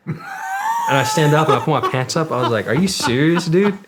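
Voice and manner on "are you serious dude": much higher and louder, a near-shout of disbelief; incredulous outrage. Retold or imitated. Telling off his own doc, loud incredulous protest. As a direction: call him out like you've been betrayed. Indignant at the medic's reaction.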